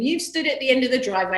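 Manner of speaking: usual speaking voice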